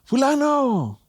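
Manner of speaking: high call across the street. Retold or imitated. Calling to someone across the street, high call. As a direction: hail someone far off by name. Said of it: "a alguien que va del otro lado de la calle".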